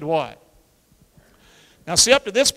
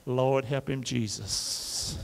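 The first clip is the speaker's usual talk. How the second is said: mock Tennessee sigh; 5 semitones lower, 4 dB quieter, much slower than usual. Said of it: "Tennessee look".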